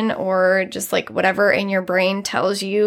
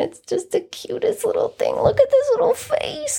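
pet voice. Real, not acted. Owner showing off bunny's little face, high cooing. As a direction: gush higher and higher over a tiny pet.

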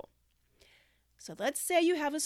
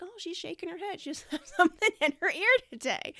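put-on clueless kid; 7 semitones higher, 4 dB louder than usual. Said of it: "They'll just like".

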